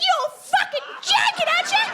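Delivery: high-pitched outraged yell; acted, incredulous shriek; furious. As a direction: imitate someone exploding in disbelief. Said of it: "Jamie's furious".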